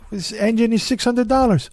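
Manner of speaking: high-pitched imitation of a Swedish neighbor's accent; Oscar's accent. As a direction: put on the foreign accent, pitched well up. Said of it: "he talk like this".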